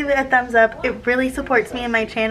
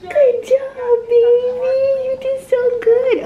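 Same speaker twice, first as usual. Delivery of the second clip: pet praise; slower than usual. Real, not acted. Praising cat after song.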